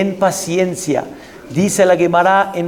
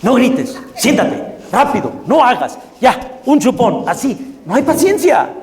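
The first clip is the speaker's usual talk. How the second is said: impatient mother yelling; 6 semitones higher than usual. Retold or imitated. Mother snapping at children.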